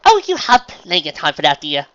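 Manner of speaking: high, loud, airy assurance; reassuring refined lady. A narrator's reading. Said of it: "Rarity assured".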